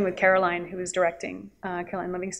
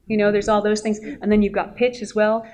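ordinary talk, nothing marked

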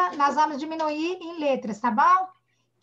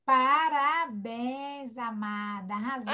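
tender praise; 4 semitones lower, slower than usual. Real, not acted. Teacher praises child's correct answer. (elogio carinhoso, baixinho e arrastado)

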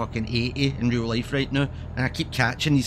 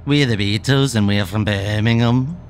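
put-on Scouse accent; 3 semitones lower, 6 dB louder than usual. Streamer posing as one of the Beatles, mock Scouse accent. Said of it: "in a Scouse accent".

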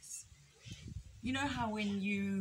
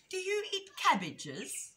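high, curious little rabbit voice; innocent young rabbit; 12 semitones higher, 4 dB louder, slower than usual. A narrator's reading. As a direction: ask it with naive interest.

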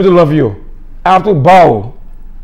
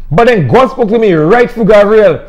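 normal talking voice

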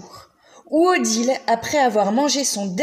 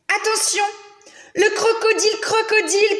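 higher, louder warning call; guard shouting; 11 semitones higher, 4 dB louder than usual. Acted. The museum guard warning of the crocodile, higher calling out. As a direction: cry out a warning to the crowd. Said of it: "cria le gardien".

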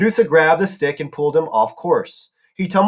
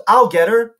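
higher, raised call; moderately loud, high battle call; yelled; 6 semitones higher, 4 dB louder than usual. A narrator's reading. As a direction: call it out eagerly mid-attack. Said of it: "he yelled".

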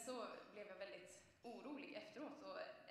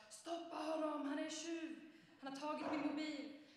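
loud, high-pitched yell after a thief; shouting and roaring; 8 semitones higher, 8 dB louder than usual. Retold or imitated. Robbed teenager yelling after a fleeing phone thief, shouting and roaring. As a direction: yell it out to stop someone getting away. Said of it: "så skrek jag och vrålade".

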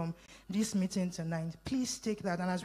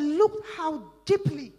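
higher, louder, drawn-out marvelling quote; emphatic quote; 7 semitones higher, 7 dB louder than usual. Retold or imitated. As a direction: stress DEEPLY, raised and stretched out. Re-enacting onlookers marvelling at Jesus.

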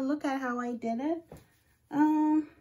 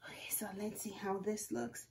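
a soft whisper, much softer; 4 semitones lower, 10 dB quieter than usual. Real, not acted. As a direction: whisper as you check your work. Checking the result carefully.

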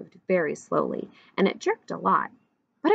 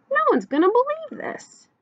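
high-pitched thrilled thought; boy's amazement; 3 dB louder than usual. A narrator's reading. Little boy watching Duck drive past, amazed.

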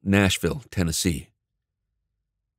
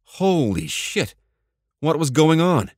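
high, slow astonishment; stunned; 7 semitones higher than usual. A narrator's reading. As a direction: gasp it out in disbelief. Narrator's stunned inner reaction.

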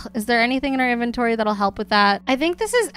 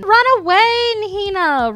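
high, loud urgent call; calling out a warning; 9 semitones higher, 6 dB louder than usual. Real, not acted. Player warns Hina off from the zombies, high urgent call. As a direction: call out to send someone running.

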